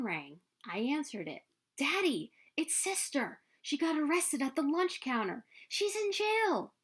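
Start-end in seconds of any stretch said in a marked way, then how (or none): 1.8-6.7 alarmed. Acted. Girl tells Daddy Sister's arrest.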